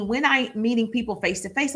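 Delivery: ordinary talk, nothing marked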